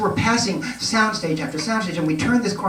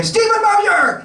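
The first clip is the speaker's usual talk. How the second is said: much higher and louder, drawn out slowly; calling out; 6 dB louder, much slower than usual. Retold or imitated. Crew member summons the photographer to the set, calling out loud. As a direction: call his name across the set.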